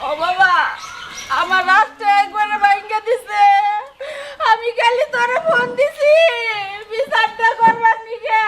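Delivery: slow, wailing complaint with stretched endings; tearful pleading. Acted. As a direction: cry to your son for justice. Sobbing on phone to son. (ছেলের কাছে কেঁদে নালিশ)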